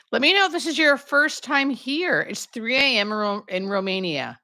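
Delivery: usual speaking voice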